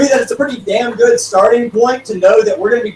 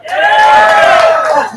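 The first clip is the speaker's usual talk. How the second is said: very high, slow, drawn-out whoop; whooping shout; 4 dB louder than usual. Real, not acted. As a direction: yell it up high and long. Crowd answers loudly, he whoops.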